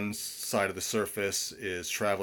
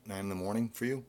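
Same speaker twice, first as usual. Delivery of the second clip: normal talking voice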